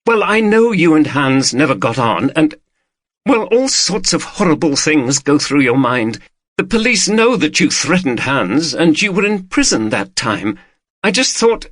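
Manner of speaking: wife admitting suspicion to husband; upset wife. A narrator's reading. Female character voice.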